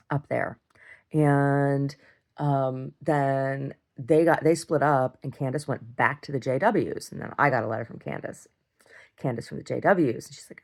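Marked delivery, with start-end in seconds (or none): none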